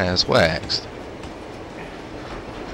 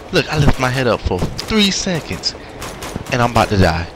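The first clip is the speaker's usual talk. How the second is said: usual speaking voice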